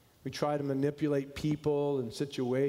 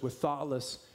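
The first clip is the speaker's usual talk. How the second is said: poem recitation; 3 semitones lower than usual. Acted. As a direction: let each line fall gently, unhurried. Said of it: "It goes like this".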